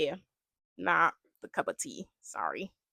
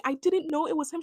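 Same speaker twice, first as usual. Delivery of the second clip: pleading excuse; 8 semitones higher than usual. Retold or imitated. Voicing contestant's repeated excuse.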